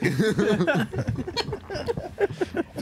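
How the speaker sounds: big high cackle; real high-pitched laughing fit. Real, not acted. Laughing at own joke.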